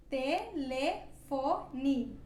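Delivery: telefone stretched right out, final e said like i; very slow model word. Shown as a demonstration. Teacher stretches telefone for learners. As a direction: draw out telefone syllable by syllable. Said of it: "como se fosse uma letra I".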